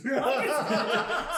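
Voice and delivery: loud, slow, laughing hard through the words. Real, not acted. Player recalling posing as a statue, laughing loudly. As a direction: crack up while telling it. Laughing while telling story.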